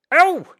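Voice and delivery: sharp high loud yelp of pain; pained cry. Acted. Eliakim yelps when the boy bites his finger, sharp cry. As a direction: yelp as a finger gets bitten. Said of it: "Eliakim cried".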